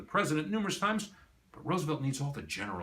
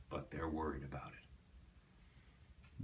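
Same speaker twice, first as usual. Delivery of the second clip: whispering; 7 semitones lower, 15 dB quieter, much slower than usual. Real, not acted. Fear of invasion, hushed aside.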